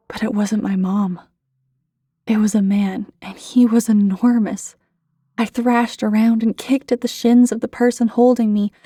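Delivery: usual speaking voice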